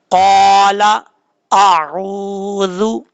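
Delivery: क़ाला आऊज़ु slowly, long vowels held steady; lengthened recitation. Shown as a demonstration. Teacher showing students how, drawn-out vowels in क़ाला आऊज़ु. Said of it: "इस तरीके से".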